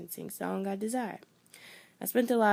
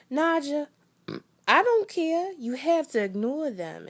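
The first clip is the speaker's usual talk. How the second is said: put-on much higher, louder East Coast accent mom; thick East Coast accent; 8 semitones higher, 5 dB louder than usual. A mom telling her daughter to ignore bullies, East Coast accent. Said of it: "in a thick East Coast accent".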